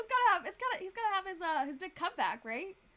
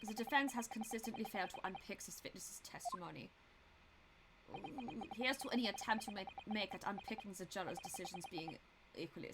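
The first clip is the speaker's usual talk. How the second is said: soft, lowered prosecutor voice; cool prosecutor; 6 semitones lower, 9 dB quieter than usual. Acted. Prosecutor toasting the defense's failed attempts, cool and quiet. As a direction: quietly dismiss the defense's efforts.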